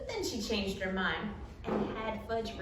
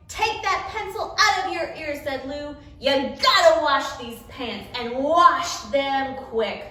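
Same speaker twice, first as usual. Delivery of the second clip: bossy demand; 3 semitones higher, 8 dB louder than usual. Acted. Lou demands from dry cleaner.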